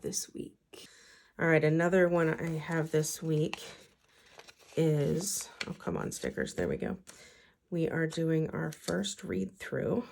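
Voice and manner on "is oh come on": ordinary delivery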